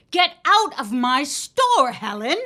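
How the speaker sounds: 怒鳴って追い出す; 店から出て行けと叫ぶ; angry shout. Acted. Ma throws Helen out.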